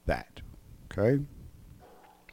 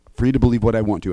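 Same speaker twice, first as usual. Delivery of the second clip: ordinary delivery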